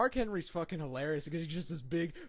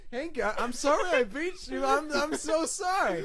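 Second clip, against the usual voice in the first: high, loud fake-sorry shout; taunting the man he beat with a loud fake sorry; mock apology; 11 semitones higher, 6 dB louder than usual. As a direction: yell a smug apology. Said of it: "He's like".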